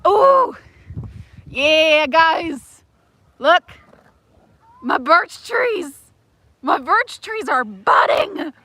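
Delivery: thrilled discovery, slow and emphatic; real excitement. Real, not acted. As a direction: crow with excitement, stretching the words. Delighted at finding budding birches.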